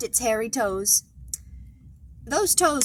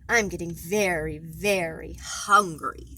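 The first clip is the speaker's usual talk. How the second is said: hungry dragon; 9 semitones lower, 4 dB quieter, slower than usual. Acted. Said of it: "said Snide".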